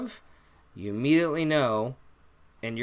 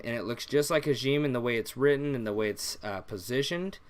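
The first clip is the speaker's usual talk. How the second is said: usual speaking voice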